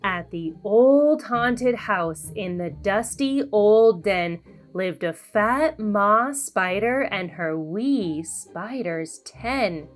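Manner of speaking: plain reading voice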